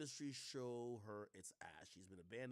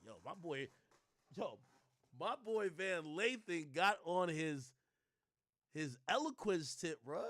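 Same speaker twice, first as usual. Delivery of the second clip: mock hype; 5 semitones higher, 9 dB louder, slower than usual. Real, not acted. Mocking Van's statement.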